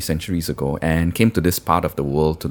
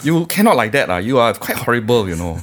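higher-pitched Singlish mock scold; Singaporean accent scolding; 4 semitones higher than usual. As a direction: tease him in Singlish, ah. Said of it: "scold me in, in Singlish".

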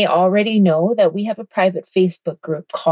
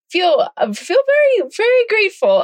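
excited; 13 semitones higher than usual. Real, not acted. Gushing about a quiet moment.

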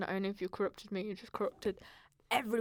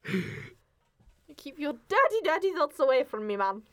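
higher, slow, indignant rebuke; mock-outraged telling-off; 5 semitones higher, 5 dB louder, slower than usual. Real, not acted. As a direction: push him away with words.

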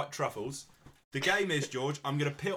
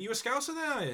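high-pitched Scouse question; imitated little Scouser; 10 semitones higher, faster than usual. Little Scouser asking the actor in the queue, high Scouse. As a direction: pipe up in a small Scouse voice. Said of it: "some little Scouser".

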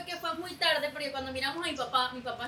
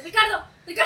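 louder retold calling by name; 6 semitones higher, 10 dB louder than usual. Mom calls her husband back from the car, loud. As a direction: call him like a mom from the doorway.

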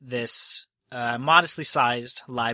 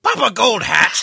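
much higher and louder, outraged; indignant nobleman; 8 semitones higher, 8 dB louder than usual. Acted. Papa Gold Hat outraged at the changed will, much higher and louder. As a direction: play him as a pompous, offended rich man. NPC offended at the nickname.

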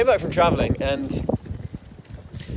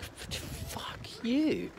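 retold angry whisper, high and breath-led; whispered outrage; 10 semitones higher, 12 dB quieter than usual. Son's silent reaction to dad, whispered outrage. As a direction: whisper a stunned curse under the breath. Retelling hurt anger at dad.

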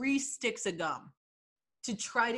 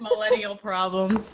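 said through laughter; laughing; 5 dB louder than usual. Real, not acted. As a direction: laugh while saying it. Laughing remark.

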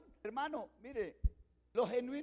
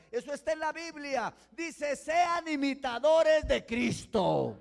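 loud, driving sermon, shouting the point home; fiery preaching; 5 semitones higher, 10 dB louder than usual. Acted. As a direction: preach it hard to the whole church. Preaching at full force. (predicación a todo pulmón, gritando)

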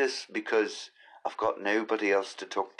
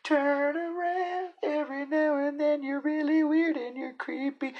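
falsetto squeak, far up the range; hammed-up falsetto parody; high squeaky mock voice. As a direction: mimic someone in a tiny high voice. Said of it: "he said".

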